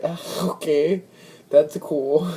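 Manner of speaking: high, uneasy acceptance; awkward politeness. Retold or imitated. Uncomfortable man humouring his date after a cheek compliment, awkwardly polite.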